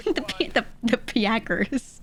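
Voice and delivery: Minnesotan PACKERS with a stretched vowel; mock Minnesota accent. Streamer savoring the parody, mock Minnesota accent. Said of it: "they're all from Minnesota".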